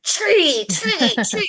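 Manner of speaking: tree barked high and loud; angry labeling. Retold or imitated. Told to label the tree but full of rage, loud. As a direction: label the tree while seething. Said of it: "Because we're filled with anger".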